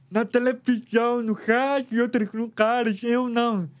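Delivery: ordinary delivery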